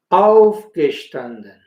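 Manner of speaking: Aufgestanden, first syllable auf strong, rest falling; stress on auf. Shown as a demonstration. Teacher shows learners the stress on auf, slowly. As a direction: hit auf, let gestanden fall away. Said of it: "Sería una sobreesdrújula".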